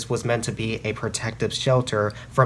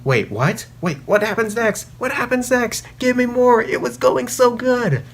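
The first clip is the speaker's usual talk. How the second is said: climbing much higher, pleading for more; imitating a baffled reader; 10 semitones higher than usual. Reviewer imitates a fan left on a cliffhanger, begging in a much higher voice. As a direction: play a hooked fan left hanging, rising higher. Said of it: "you're left wondering".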